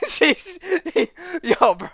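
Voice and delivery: breathless with laughter, high and loud; laughing. Real, not acted. Reacting to the absurd comic, laughing too hard to talk. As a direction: try to speak while cracking up. Still cracking up.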